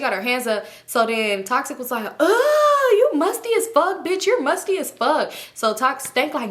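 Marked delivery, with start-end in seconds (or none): 2.2-5.3 high, loud laughing taunt; loud mocking insult. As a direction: shriek the insult at her. Toxic insulting Stank loudly.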